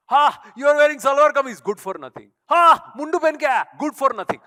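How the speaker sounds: loud, high sneering taunt; mocking sneer. Speaker mocking snobs who sneer at Indian dress, loud jeering taunt. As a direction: jeer at the traditional clothes.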